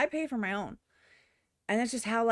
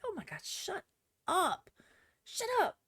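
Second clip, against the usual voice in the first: much higher, whiny fed-up groan; exasperated shut up; 9 semitones higher, 5 dB quieter than usual. Retold or imitated. Reacting to people who say shit like that, exasperated and much higher. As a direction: beg them to stop, at the end of your rope. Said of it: "I'm like".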